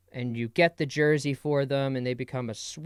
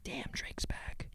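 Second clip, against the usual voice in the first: slow, hushed mock awe; awed whisper; 15 dB quieter, slower than usual. Fan hearing [name]'s new album, awed whisper. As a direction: whisper it slowly, as if stunned.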